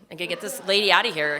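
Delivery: fast, hurried brusque line; doctor's brusque thought. Retold or imitated. Doctor wanting patient gone, fast and brusque. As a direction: rush it out like a fed-up doctor. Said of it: "his reaction was he".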